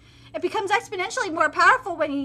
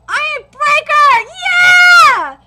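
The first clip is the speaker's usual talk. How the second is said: high, loud, slow battle cry; triumphant shout; 9 semitones higher, 15 dB louder, slower than usual. Acted. Fighter lands his attack, shouting in triumph. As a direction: roar the move then cheer.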